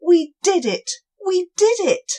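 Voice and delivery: high, loud and gleeful; excited cheer. Acted. Cobwobblies celebrating their win. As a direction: bounce with triumph.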